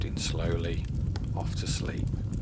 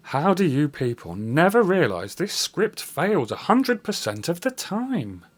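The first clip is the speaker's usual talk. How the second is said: ordinary delivery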